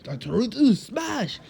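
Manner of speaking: hammed-up hero yell, peaking on smash; hero battle cry. All Might imitated attacking Deku.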